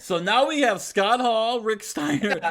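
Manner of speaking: loud, high, slow disbelief; a loud, high, slow, incredulous recap; exasperated. Real, not acted. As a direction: spell out the mess in loud mock outrage.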